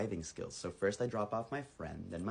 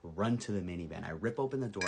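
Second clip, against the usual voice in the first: ordinary delivery, nothing marked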